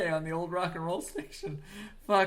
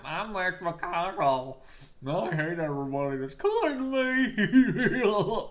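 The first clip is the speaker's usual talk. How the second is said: slow, drawn-out politician caricature, rising high; Mitch McConnell; 4 semitones higher, 4 dB louder, slower than usual. Acted. Host mocking a politician's way of talking, slow caricature. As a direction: stretch every word into a wailing send-up. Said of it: "how he sounded like this".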